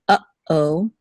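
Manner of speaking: uh-oh with a stop after uh; pause between syllables. Shown as a demonstration. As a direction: break uh-oh cleanly in two. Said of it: "there's a pause between uh".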